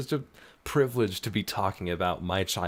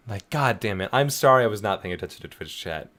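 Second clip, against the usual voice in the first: usual speaking voice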